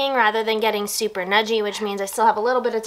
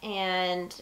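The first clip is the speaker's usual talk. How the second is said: normal talking voice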